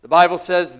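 ordinary talk

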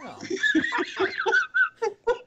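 high shrill burst of laughter; shrieking laugh. Real, not acted. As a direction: crack up loudly.

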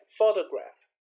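"photograph" with a strong first syllable; first-syllable stress. Shown as a demonstration. Teacher repeats the stress example, "photograph" stressed on the first syllable. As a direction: lean on the opening syllable of "photograph". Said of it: "hear the stress in the first".

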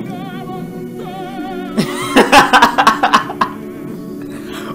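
high unvoiced laugh; shocked laughter. Real, not acted. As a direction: laugh in disbelief. Laughs at video.

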